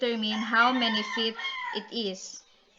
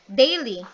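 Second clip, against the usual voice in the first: "daily" with a strong DAI; strong "dai", light "ly" in "daily"; falling meter; 6 dB louder than usual. Shown as a demonstration. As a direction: punch the first syllable of "daily", then ease off. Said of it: "they move from stressed to unstressed".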